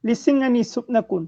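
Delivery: measured declamation in separate phrases; strong line openings, falling cadence; poem recitation. Acted. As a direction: recite verse line by line with pauses. Recites own poem on request.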